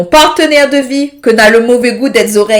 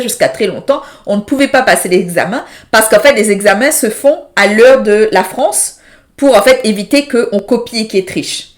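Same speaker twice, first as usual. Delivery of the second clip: usual speaking voice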